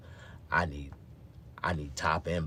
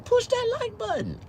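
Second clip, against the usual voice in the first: much higher squeaky voice; squeaky plea; 5 dB louder than usual. Pretend. Begging viewers for likes, high squeak.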